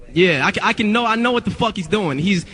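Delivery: normal talking voice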